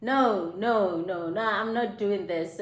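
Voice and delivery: ordinary talk, nothing marked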